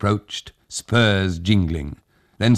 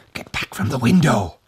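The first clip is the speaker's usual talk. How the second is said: strangled shout; 6 semitones higher than usual. Acted. Old Tag warning the women off the window, strangled shout. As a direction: push it out through a tight throat. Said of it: "shouted in a strangled voice".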